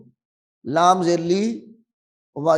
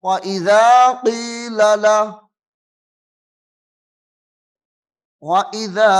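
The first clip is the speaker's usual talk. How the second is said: slow melodic tajweed recitation; measured Quranic recitation; much slower than usual. Acted. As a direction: chant the verse, drawing out the vowels.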